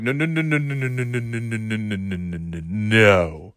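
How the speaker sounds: long string of no's, sinking and fading; disapproving dad. Retold or imitated. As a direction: run the no's together, then drag the last one out. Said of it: "and then my dad's like".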